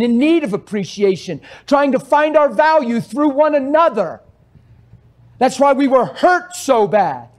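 loud, high preacher's cry; fiery preaching. Acted. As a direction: preach it with rising fire. Preaching at full force.

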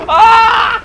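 high, loud scream; mock death scream. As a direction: scream as you get killed in a game. Killed in the game.

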